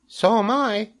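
very high little creature; third tiny voice. A narrator's reading. Third spider joins in, tiny high voice. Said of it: "said a third voice".

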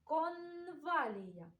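"конвалія" slowly, hard N held long; hard N highlighted. Shown as a demonstration. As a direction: linger on the н in "конвалія". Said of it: "Твердий приголосний звук Н".

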